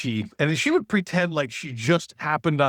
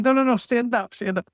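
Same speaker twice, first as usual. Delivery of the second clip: insistent teacher; 11 semitones higher than usual. Retold or imitated. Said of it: "she's like".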